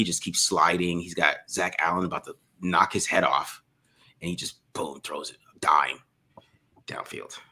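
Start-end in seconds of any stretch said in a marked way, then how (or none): 4.2-7.4 hushed awed whisper. Real, not acted. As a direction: breathe it out in awe. Awed at the throw.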